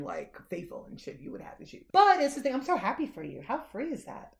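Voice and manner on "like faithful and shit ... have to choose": hushed aside. Real, not acted. Mock-serious aside.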